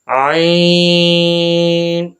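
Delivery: عین held very long, clean from the throat; correct recitation. Shown as a demonstration. Teacher giving students the correct عین, long and steady. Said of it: "تو اس کی صحیح ادائیگی".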